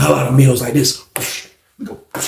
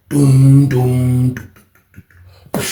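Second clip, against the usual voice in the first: doom doom punch sounds; 4 semitones lower, much slower than usual. Retold or imitated. Imitating punches landing.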